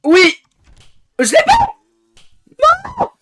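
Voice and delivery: high victory yell; ecstatic shout. Real, not acted. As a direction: scream with pure joy. Winning moment in game. (cri de victoire)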